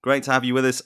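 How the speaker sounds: normal talking voice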